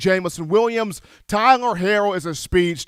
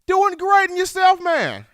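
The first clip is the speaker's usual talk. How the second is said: cheery reply; 11 semitones higher than usual. Real, not acted. Host answers caller cheerfully.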